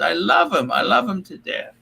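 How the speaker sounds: usual speaking voice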